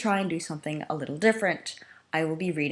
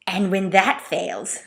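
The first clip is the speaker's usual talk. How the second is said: sly slow Starscream; 3 semitones higher, 4 dB louder, much slower than usual. Acted.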